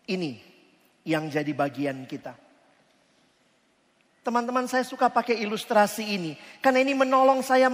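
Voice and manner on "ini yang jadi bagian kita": much softer and lower, grave; hushed. Acted. Preacher letting the verdict on sin land, hushed. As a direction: let it sink in quietly. Sermon drops to hush.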